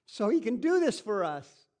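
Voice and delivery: normal talking voice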